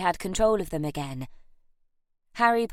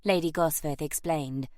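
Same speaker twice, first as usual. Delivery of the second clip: usual speaking voice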